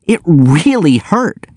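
slow, high-pitched wounded protest; shooting back. A narrator's reading. Narrator insisting to Abigail that his nose hurt, shooting back. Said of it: "I shot back".

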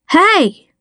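high-pitched call out; shouted hail. A narrator's reading. Deputy hailing the team from downstream, shouted. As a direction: call out to get attention. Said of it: "she shouted".

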